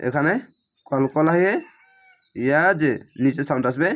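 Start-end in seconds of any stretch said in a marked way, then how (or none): none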